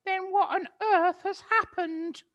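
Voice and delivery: put-on high, loud old-woman cry; crying out in alarm. Missus Twit frightened, crying out to her husband. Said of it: "cried Missus Twit".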